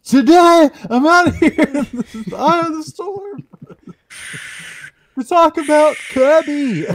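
very high, loud storm reporter yell. Pretend. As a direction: shout it like a reporter in a hurricane. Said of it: "do weatherman".

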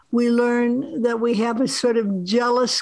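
usual speaking voice

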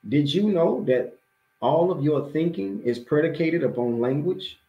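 usual speaking voice

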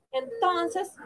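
plain reading voice

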